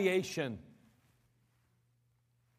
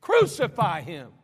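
loud shouted cry, the second slower; a preacher voicing the mob calling for crucifixion, shouting; crowd crying out; 10 dB louder than usual. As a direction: bellow it like an angry mob. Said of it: "think about them as they're crying".

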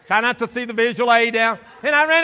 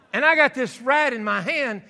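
ordinary talk, nothing marked

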